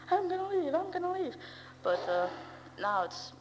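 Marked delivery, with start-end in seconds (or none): 0.1-1.4 high, whiny repeated threat; stepdad threatening. Retold or imitated. Stepdad Eddie during a family fight, high whiny threat. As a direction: threaten to leave like a sulking man. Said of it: "Eddie's still saying".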